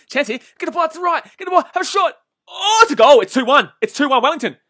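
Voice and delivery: high, loud shouted commentary; real live goal yell; excited goal call. Real, not acted. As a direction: scream it as the ball goes in.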